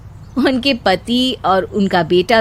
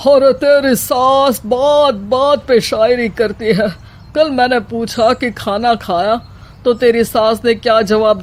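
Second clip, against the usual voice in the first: a hint of a fed-up father-in-law; lightly voiced father-in-law. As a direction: grumble about his poet wife.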